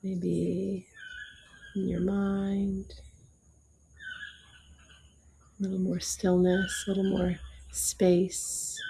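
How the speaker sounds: gentle yoga teacher voice counting breaths; guided meditation. Acted. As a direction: ease them through each breath, unhurried.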